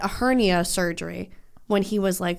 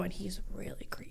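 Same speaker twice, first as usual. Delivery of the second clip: whispering; 2 semitones lower, 16 dB quieter than usual. Real, not acted. Whispered creepy remark.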